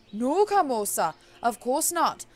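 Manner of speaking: put-on slow, loud witch doctor with a wobbly Jamaican accent; faltering Jamaican accent. Witch doctor tells the girl she knows her, faltering Jamaican accent. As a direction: barely hang on to a mock Jamaican accent. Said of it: "barely hanging on to it by a thread".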